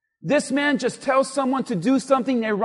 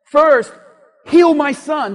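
loud, higher mock command; bossy instruction; 5 semitones higher, 10 dB louder, slower than usual. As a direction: act out laying down orders. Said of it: "this man tells Jesus how to do it".

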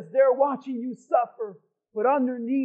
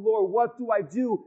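ordinary talk, nothing marked

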